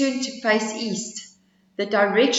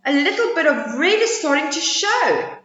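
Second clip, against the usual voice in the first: higher small turtle voice; 7 semitones higher, 4 dB louder than usual. Acted. Turtle telling the animals dawn is coming, higher voice. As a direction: point it out eagerly.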